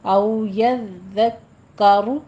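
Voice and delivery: यज़्ज़करु with a full heavy रु; tajweed demo. Shown as a demonstration. Teacher stresses the heavy ra. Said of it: "रॉ यहाँ पे भी पुर है".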